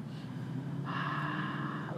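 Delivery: long, soft voiceless sigh; audible yoga out-breath; demonstrated exhale. Shown as a demonstration. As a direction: let the breath all the way out.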